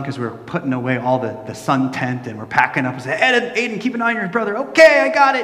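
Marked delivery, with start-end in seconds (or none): 3.2-4.6 higher-pitched parental instruction, re-enacted; dad calling out. Dad packing up tells older son to watch brother, calling out. 4.6-5.4 put-on high, loud little-boy voice; eager kid reply. Older son answering dad at the beach, eager kid voice.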